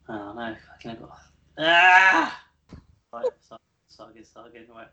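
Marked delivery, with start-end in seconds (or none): 1.6-2.4 frustrated shout. Real, not acted. Intro ruined again, frustrated cry.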